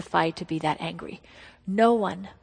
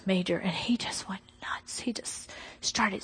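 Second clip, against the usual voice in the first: breathy hush, slow and unvoiced; hushed, slow storytelling whisper; 7 dB quieter than usual. Real, not acted. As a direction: drop to a secret whisper for the scary part. Hushed retelling of husband's rage.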